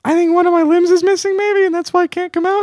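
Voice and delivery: high falsetto woman's voice; man doing a woman's voice. Pretend. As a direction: squeak it out like a girlfriend on the phone. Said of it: "Do you like my girl voice?".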